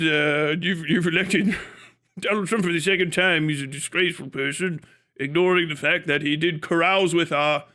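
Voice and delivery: low-pitched, halting British pundit parody; mock British scolder. British critic scolding America over election, mock-stuffy low voice.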